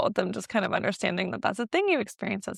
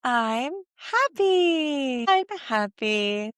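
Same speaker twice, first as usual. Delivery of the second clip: happy; 5 semitones higher, much slower than usual. Shown as a demonstration. Demo of happy to baby.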